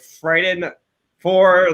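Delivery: ordinary talk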